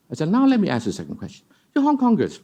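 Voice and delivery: normal talking voice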